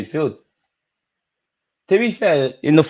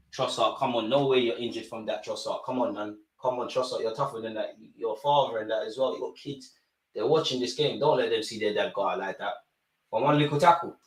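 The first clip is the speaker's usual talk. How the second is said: muttered scolding; 11 dB quieter than usual. Real, not acted. Scolding injured player softly.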